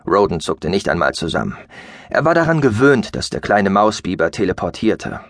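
plain reading voice

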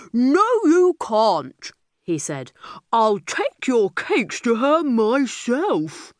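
slow, heavy bear voice; bossy bear. A narrator's reading. Big bear tells captured girl to work for him. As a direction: growl orders at the little girl.

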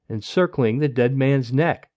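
ordinary delivery, nothing marked

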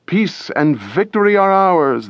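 high, joyful cry of triumph; women crying out in celebration; joyous cry. A narrator's reading. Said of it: "they cried joyously".

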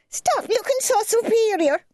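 put-on high, jeering child's voice; mock a sister in a shrill high jeer; bratty girl crying out. A narrator's reading. Girl jeers at her older sister, high and taunting. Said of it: "Chrissy cried out".

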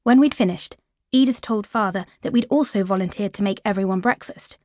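ordinary delivery

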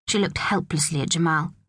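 plain reading voice

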